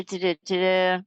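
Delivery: ordinary talk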